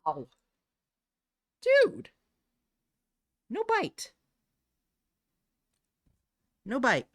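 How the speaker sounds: scolding her cat after a bite, high and loud; startled scolding. Real, not acted. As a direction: yelp then scold the biting cat.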